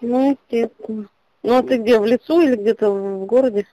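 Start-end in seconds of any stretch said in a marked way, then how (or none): none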